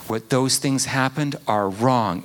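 ordinary talk

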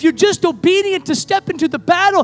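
loud, high preacher's build; fervent revival cry; preaching at full force. Acted. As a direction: drive it home to the congregation.

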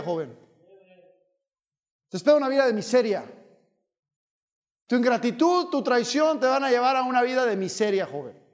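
a firm rebuke from the pulpit, sometimes quickening; preaching. Acted. Preacher rebuking ungrateful youth, stern preaching. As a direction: warn the grumbler sternly.

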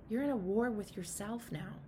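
ordinary talk

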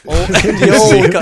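laughing through the words, loud and high; real laughter at a misunderstanding. Real, not acted. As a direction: crack up mid-word. Laughing at misheard phrase.

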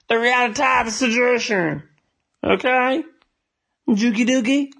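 high put-on cartoon voice; silly high voice. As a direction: toss it off in a goofy voice. Sudden silly voice aside.

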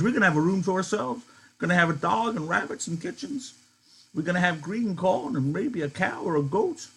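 put-on high, pleading old-man voice, swept up in the dream; an old man begging to believe; Candy crying out. Candy answering Crooks, desperate for the farm, high and fervent. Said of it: "Candy cried".

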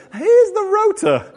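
high, slow singsong mockery; mock singsong announcement. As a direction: sing it out like a dreaded email. Mocking the rota email.

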